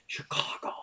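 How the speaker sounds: breathy whispered word; whispering. Real, not acted. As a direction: whisper the name softly.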